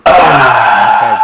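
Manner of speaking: shouted okay to get attention; loud call. Real, not acted. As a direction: call out to the table. Calling the group to order.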